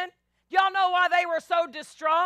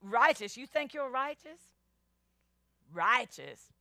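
mocking scorn; 2 semitones lower, 4 dB quieter than usual. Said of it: "they're gonna say".

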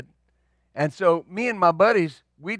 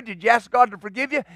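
normal talking voice

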